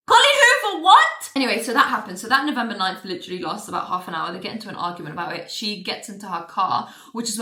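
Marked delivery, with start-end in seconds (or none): 0.1-1.3 very high, loud squawk of disbelief; flabbergasted, shooting up in pitch; incredulous outburst. Real, not acted. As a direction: shriek the question at the author.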